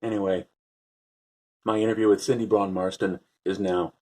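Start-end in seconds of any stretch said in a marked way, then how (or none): none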